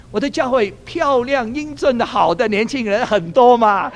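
high, loud playful boast; comic sales pitch to cheer someone up; hammed-up enthusiasm, building to a punchline; joking. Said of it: "我就跟他说笑了".

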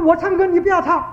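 put-on high-pitched, indignant child's order; mock scolding. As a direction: play a cross child calling out to a rival. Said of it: "他就骂那个小孩子". (模仿孩子高声责骂)

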